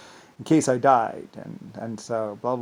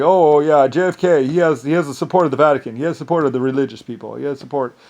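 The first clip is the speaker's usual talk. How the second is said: mock eager gossip; 3 semitones higher, 11 dB louder than usual. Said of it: "people were saying".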